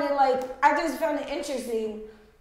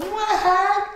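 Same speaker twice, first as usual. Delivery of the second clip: high-pitched, louder mascot voice; costumed Mickey character; 11 semitones higher, 6 dB louder than usual. Retold or imitated. Costumed stranger offering a tourist a hug, high mascot voice. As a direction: squeak it out like a pushy costumed mascot. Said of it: "in a Mickey Mouse outfit, saying".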